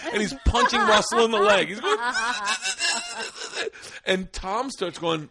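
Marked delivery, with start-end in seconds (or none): 2.0-4.0 high, voiceless wheezing laugh; imitated stifled laugh. Clayton punching Russell's leg, wheezing held-in laugh. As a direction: mimic laughter squeezed out while holding it in. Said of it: "he's trying to hold it in".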